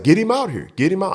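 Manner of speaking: high-pitched angry demand; acted heated insistence; insistent repeating. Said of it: "I'm steady repeating".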